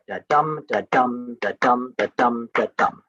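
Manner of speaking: da dum with the stress on dum, a steady beat; iambic da dum. Shown as a demonstration. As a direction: tap out the iambic rhythm, weak then strong. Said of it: "emphasis on the second beat".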